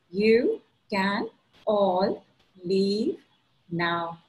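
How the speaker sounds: stretched slowly; slow drawn out. Acted. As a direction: draw the words out slowly. Final slow repetition of mantra.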